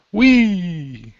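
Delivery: high, loud whee of glee; a kid on a swing, all joy; gleeful whee. Acted. [name] whirling a skeleton through the horde, high gleeful cry.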